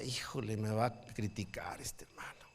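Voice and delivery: put-on young pastor whispering his fear of the old critic; whispered worry. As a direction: breathe it out, afraid of being judged. Pastor's nervous inner thought.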